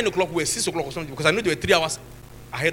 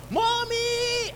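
mock child's scream. Father retelling his daughter's swollen cheek, mock scream. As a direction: imitate a little girl screaming for her mother. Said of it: "and she was screaming".

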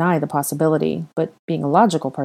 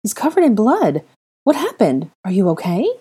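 higher, urgent alarm over the blood; startled worry rising in the voice; alarmed gasp; 5 semitones higher, 4 dB louder than usual. Acted. Hermione finding blood on the boy, alarmed. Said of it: "she gasped".

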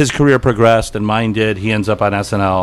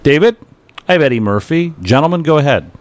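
raised, formal operator announcing a call; phone operator voice; 6 semitones higher, 3 dB louder than usual. Retold or imitated. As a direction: announce the call like a crisp switchboard woman. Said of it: "a woman said".

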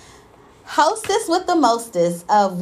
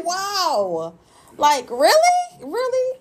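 incredulous; 9 semitones higher, slower than usual. Retold or imitated. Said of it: "I said".

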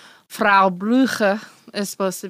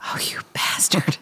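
real whispered curse, pitched low; 4 semitones lower than usual. Real, not acted. Co-host tricked by the joke, whispered curse. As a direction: whisper it like you've just been had.

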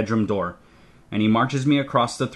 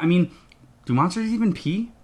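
ordinary delivery, nothing marked

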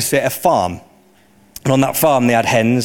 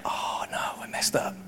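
hushed, voiceless self-reproach; whispered regret; 15 dB quieter, slower than usual. Acted. Peter waking to the rooster's sound, whispered regret. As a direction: whisper it to yourself in dismay.

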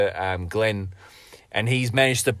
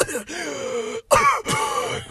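a long hacking cough, high and strained; wet dying cough. Acted. Dying survivor coughing blood at the narrator's feet, a hacking cough.

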